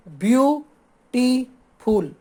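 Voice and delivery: Beautiful very slowly as view, टी, फुल; three drawn-out beats of beautiful with clear pauses; syllable by syllable. Shown as a demonstration. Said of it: "ये हो गया तीन syllable". (Beautiful को तीन हिस्सों में रुक-रुककर बोलें)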